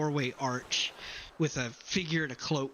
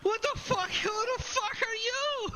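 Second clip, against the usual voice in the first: very high, startled outcry; acted high-pitched shock; alarmed bard. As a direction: react as if grabbed by a lunatic. Bard reacts to being grabbed.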